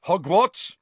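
loud, high, abrupt question. A narrator's reading. Manager greets Harry in the bookshop, abruptly. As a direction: fire it out abruptly. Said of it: "he said abruptly".

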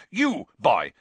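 put-on loud, angry bark at the boy; furious uncle's bark; snarling Uncle Vernon. A narrator's reading. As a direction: snap him into line. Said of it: "he snarled at Harry".

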